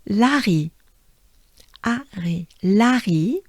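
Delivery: "Larry" with a throaty French R, la-ri; "Larry" rolled in the back of the throat like French. Shown as a demonstration. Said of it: "with a French accent".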